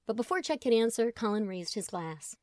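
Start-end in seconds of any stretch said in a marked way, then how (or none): none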